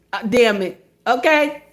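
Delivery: usual speaking voice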